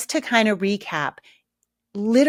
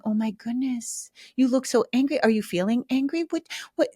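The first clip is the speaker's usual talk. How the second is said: mock fretful concern; 5 semitones higher than usual. Parent fussing over an angry child, mock fretful concern. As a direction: fuss over the child in a small, higher voice. Mocks the wrong parent response.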